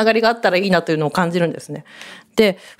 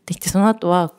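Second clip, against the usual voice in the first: ordinary talk, nothing marked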